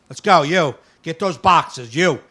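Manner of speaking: loud, bossy barked orders; rude barking. Retold or imitated. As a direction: shout orders at the workers. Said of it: "that's how he talked to you".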